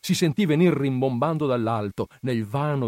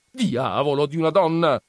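loud, raised-pitch cursing; exasperated exclamation; 4 semitones higher, 5 dB louder than usual. A narrator's reading. Perpetua shoving Agnese away to get free, exasperated exclamation. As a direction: burst out in exasperation. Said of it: "esclamò Perpetua".

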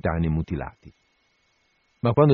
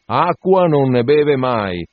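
raised voice, slow and mocking, draws out the words; loud mocking shout; 4 semitones higher, 6 dB louder, slower than usual. A narrator's reading. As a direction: mocks the surgeon at the top of his voice. (urla beffarda e lenta)

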